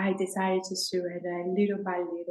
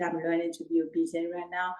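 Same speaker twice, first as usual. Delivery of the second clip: usual speaking voice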